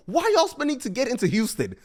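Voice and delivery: high, loud disbelief; ranting at people paying to get into Houston clubs; incredulous. Real, not acted. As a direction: throw up your hands at the spending. Incredulous rant about Houston prices.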